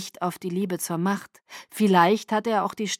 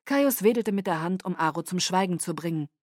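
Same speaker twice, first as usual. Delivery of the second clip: usual speaking voice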